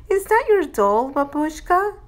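put-on high, eager child voice; high-pitched childish question; curious little girl. A narrator's reading. Child asks curiously in story.

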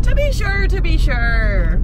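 amateur Irish lilt, high then drawn out slowly; attempted Irish accent. Shown as a demonstration. Daughter echoing mother's attempt, exaggerated Irish accent. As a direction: give your best mock Irish accent. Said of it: "that's my best attempt as well".

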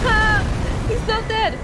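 high, loud cry of alarm; alarmed yelling. Real, not acted. Player finding the enemy still alive, yelling in alarm. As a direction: yell it in high-pitched panic. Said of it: "Sorry, I'm yelling again".

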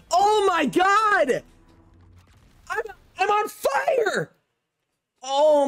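high-pitched, stretched-out cries of amazement; excited disbelief. Real, not acted. Gamer reacting to a second rare pull, excited disbelief. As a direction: shout like you cannot believe your luck.

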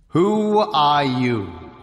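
slow, loud and huge, rolling like an avalanche; a frost giant's thundering question; booming giant voice. A narrator's reading. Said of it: "The voice tumbled across the plain like an avalanche".

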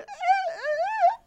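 high, tearful, speechless uh; choked-up uh through tears; tearful blank uh. Retold or imitated. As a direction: forget your own name mid-sob. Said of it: "And I looked at him and I said".

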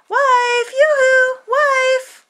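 high, loud sing-song call. Acted. Farmer calling his wife from the field, high and loud.

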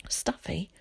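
soft whispered query. A narrator's reading. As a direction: whisper it back, puzzled. Answers a whispered confidence.

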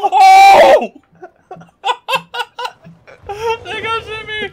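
loud high laughing yell; laughing shout. Real, not acted. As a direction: howl with laughter. Teammate run over.

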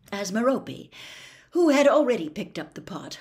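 ordinary delivery, nothing marked

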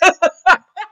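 loud, high burst of laughter; real laughter. Real, not acted. Recapper tickled by the triangle joke, loud laughing. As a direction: crack up loudly.

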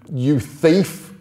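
loud, high furious yell; shouting. Acted. Angry boy shouting for the book thief. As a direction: bellow the accusation, spitting thief. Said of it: "And now he was shouting".

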